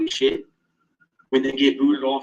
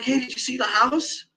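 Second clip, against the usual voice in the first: higher, put-on innocent voice of the guy; mock friend voice; 9 semitones higher than usual. Teasing friend asks about the fake house, mock innocent. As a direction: play the friend, faking innocence. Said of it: "He goes, like".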